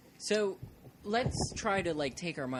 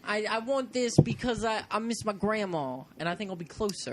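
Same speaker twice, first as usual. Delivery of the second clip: mock Italian accent; 5 semitones higher than usual. Customer orders grandma coffin pizza in hammed-up Italian accent. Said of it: "the Italian accent".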